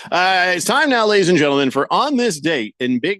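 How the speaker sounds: a hint of a ringmaster; segment announcer. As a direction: introduce the feature like a show host.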